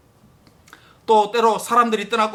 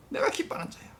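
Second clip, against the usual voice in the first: whispered; 5 semitones higher, 11 dB quieter than usual. Acted. Repeats God's words, unvoiced.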